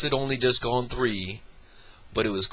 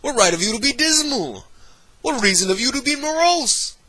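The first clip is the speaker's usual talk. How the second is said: much higher, quick and teasing; nephew's playful voice; 13 semitones higher, 5 dB louder than usual. Acted. Nephew teasing Scrooge with his own words, playful high voice. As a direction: toss his own words back at him cheerfully.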